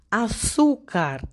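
"Azúcar" slowly, with a drawn-out ZÚ; teacher's slow "Azúcar", leaning on "zú"; stress on ZÚ. Shown as a demonstration. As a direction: stretch the middle syllable of "Azúcar". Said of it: "la sílaba más fuerte".

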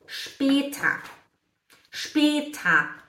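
später lento, começando com SH. Shown as a demonstration. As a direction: diga später como “shpêta”. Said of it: "ele tem som de sh". (später com som de “x” no início)

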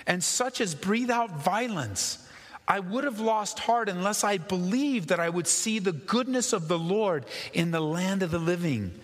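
high, ringing psalm reading in full preacher's cry; preaching at full force. Acted. Pastor reading the psalm aloud to his congregation, in full preaching force. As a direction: proclaim the psalm like a call to the whole church.

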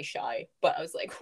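usual speaking voice

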